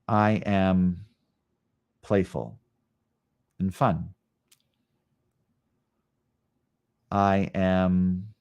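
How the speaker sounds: slow, calm affirmations spaced by long silences; guided meditation. Acted. Yoga teacher leads live class through I am practice, slow and calm. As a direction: sink into each statement slowly.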